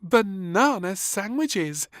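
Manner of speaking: high, loud, slow delighted maid. A narrator's reading. Maid names the sandwiches with delight. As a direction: savour the words like a maid.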